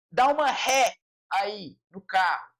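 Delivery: ordinary delivery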